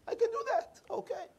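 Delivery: very high, small voice for the janitor; high-pitched janitor. Retold or imitated. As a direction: agree in a squeaky high pitch. Voicing the janitor agreeing to help.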